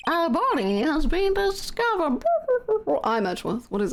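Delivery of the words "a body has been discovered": Monokuma impression. Shown as a demonstration. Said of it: "That's not really Monokuma".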